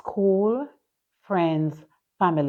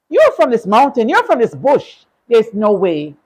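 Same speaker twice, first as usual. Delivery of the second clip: higher and louder dismissive jab; scornful taunt; 4 semitones higher, 7 dB louder than usual. Retold or imitated. People telling her she is from the bush, louder and scornful.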